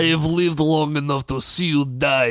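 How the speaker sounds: put-on over-the-top Russian villain accent. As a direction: say it like a doomed Russian hero, grandly. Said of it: "I could say anything in a Russian accent".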